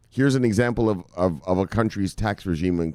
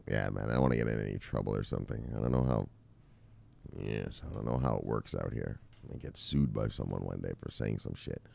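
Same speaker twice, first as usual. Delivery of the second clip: much lower and softer, muttered under the breath; a hushed, wary aside about getting sued; quiet aside; 12 semitones lower, 13 dB quieter than usual. Real, not acted. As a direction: mumble it like you shouldn't be saying it. Nervous legal aside to viewers.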